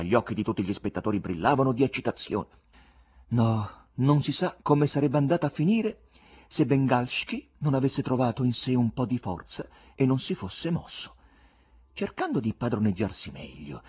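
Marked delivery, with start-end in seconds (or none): none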